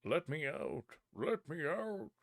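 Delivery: high, muffled cry from underground; smothered cry. Acted. Trapped spirit crying out from under the oak, high and muffled. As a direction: call faintly from inside a buried bottle. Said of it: "crying in a very smothered voice".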